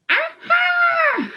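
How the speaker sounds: high, loud witchy cackle of triumph; bad fairy's cry. A narrator's reading. Bad fairy gloating at the king, a shrill witchy shout. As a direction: crow it out like a gleeful wicked fairy. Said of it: "cried the bad fairy".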